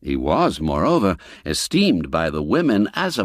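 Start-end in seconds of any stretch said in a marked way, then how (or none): none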